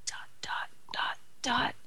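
breathy whispered "dot, dot". Pretend. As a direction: whisper each "dot" softly. Whispered ellipsis in poem.

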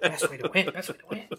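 hushed, secretive repeat; stage whisper. Acted. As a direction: breathe it like a secret to the crowd. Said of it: "full on stage whisper".

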